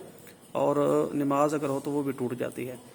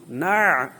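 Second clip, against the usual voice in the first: normal talking voice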